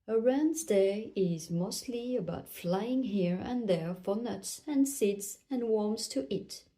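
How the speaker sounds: ordinary delivery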